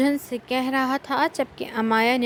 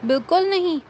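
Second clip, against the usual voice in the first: firm, louder and higher emphatic no; wife flatly refusing, louder and higher; determined refusal; 7 semitones higher, 5 dB louder than usual. Acted. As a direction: slam the door on the idea. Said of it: "وہ بڑے عزم سے بولی".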